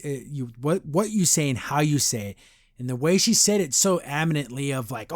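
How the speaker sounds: normal talking voice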